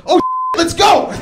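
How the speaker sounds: high, panicky getaway call; urgent call. Retold or imitated. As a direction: sound the alarm to bolt. Blanco, found out, calls to flee.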